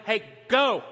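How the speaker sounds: loud, urgent call to move; mock yell from the back of the line; retold yelling. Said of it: "In the back, I'm yelling".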